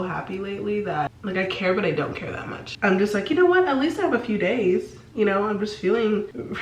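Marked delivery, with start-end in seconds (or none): 3.3-4.9 upbeat. Retold or imitated. Quoting her carefree inner thought.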